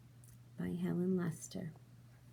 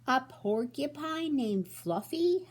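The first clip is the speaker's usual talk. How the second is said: higher, slow, laughing disbelief; howling with laughter; 8 semitones higher, 5 dB louder, slower than usual. Acted. Rhino laughing at porcupine's name, howling. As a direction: howl the name in mirth. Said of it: "howled the rhinoceros".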